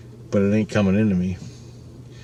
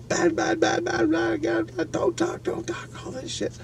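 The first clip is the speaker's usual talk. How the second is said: high, airy whispered warning chant; mock a chorus of frantic inner voices, breathy and high; voices screaming warnings; 8 dB quieter than usual. Good inner voices warning him not to talk, high whispered chant. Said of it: "the good voices in my head are screaming".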